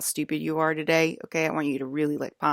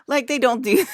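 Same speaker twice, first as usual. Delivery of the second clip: pitched way up and louder, disbelieving; incredulous outburst; 10 semitones higher, 7 dB louder than usual. Acted. Vlogger scoffing at moms defying her, pitched-up disbelief. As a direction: throw the words up high in disbelief. Mocking outburst, high and loud.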